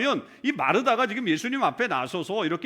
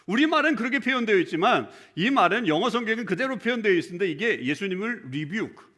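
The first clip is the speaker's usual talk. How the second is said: ordinary delivery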